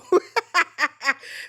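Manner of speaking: a bright amused laugh. Real, not acted. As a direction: burst of real laughter. Laughing at the rejection.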